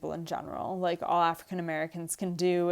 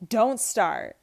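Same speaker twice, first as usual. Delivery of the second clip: sharp raised snap; a mild read-aloud yell; 4 semitones higher, 6 dB louder than usual. A narrator's reading. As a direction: bark it back at her.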